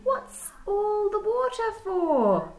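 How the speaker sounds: high, slow squeaky critter voice; a curious little platypus asking. Acted. Platypus quizzing Dingo beside the billabong, high and squeaky.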